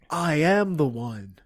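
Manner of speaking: slow, dopey surfer-dude drawl; Keanu Reeves impression. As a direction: drag it out like a spaced-out Keanu. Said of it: "you still did a Keanu Reeves impression".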